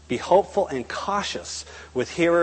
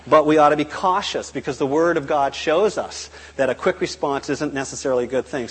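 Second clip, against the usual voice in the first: normal talking voice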